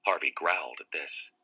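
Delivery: ordinary delivery, nothing marked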